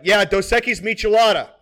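imitating an oblivious loud diner; unwitting yell. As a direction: blurt the order far too loudly. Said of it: "I yell all the fucking time".

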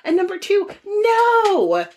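very high, incredulous "no"; emphatic acted refusal. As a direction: reject it outright, voice shooting way up. Acts out her indignant rebuttal.

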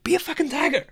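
retold motivational yell; emphatic repeat. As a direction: drive it home at a high pitch. Repeats order after client says "What?.

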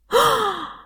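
sharp high gasp; shocked gasp. Shown as a demonstration. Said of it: "one sound of shock".

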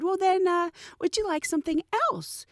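courteous retold offer; polite offer. Quoting herself offering a passenger another.

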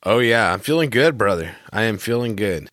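ordinary delivery

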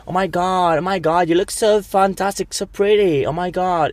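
high, gushing fake-sweet celebrity; mocking a diva. Mocking a fake diva in public, high and gushing. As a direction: coo over the friend in a put-on sweet voice. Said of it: "está en la vida en plan".

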